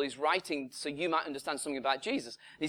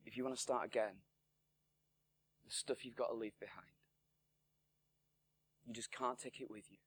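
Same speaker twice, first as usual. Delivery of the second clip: low, soft, solemn counsel; Jesus to Nicodemus; 4 semitones lower, 10 dB quieter than usual. Acted. Jesus telling Nicodemus to start again, low and soft. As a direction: speak it gently and quietly, lower. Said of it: "And Jesus says to Nicodemus".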